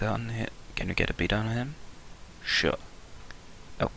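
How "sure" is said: a single gruff, low-pitched "Sure."; deep voice. Acted. Answering Annie about the gun, in a put-on deep voice. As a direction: drop the voice low for one word. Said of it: "that's a rather deep voice".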